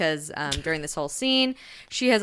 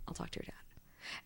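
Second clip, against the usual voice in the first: whisper; 3 semitones higher, 12 dB quieter than usual. Retold or imitated. Ursa aside while dad's on phone.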